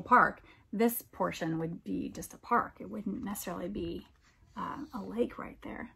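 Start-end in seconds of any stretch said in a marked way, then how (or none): 4.6-5.9 soft breathy aside; whisper. Real, not acted. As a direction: whisper it like a little secret. Aside to listeners.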